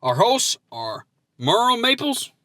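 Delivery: usual speaking voice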